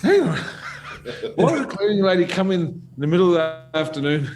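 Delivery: high, mock-indignant questioning; incredulous challenge. Challenging Sonny's cleaning lady excuse, incredulous. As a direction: act out disbelief at a lame excuse, amused. Retold argument over missing pills.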